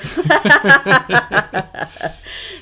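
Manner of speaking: bursting into a loud laugh; a big real laugh at her own joke. Real, not acted. Laughing loudly after quoting the anthem line.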